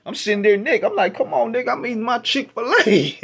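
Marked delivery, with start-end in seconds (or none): 1.2-3.2 higher, a laugh breaking through; laughing protest. Retold or imitated. Eating in the car, he laughs at the gross lyric. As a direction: complain while cracking up.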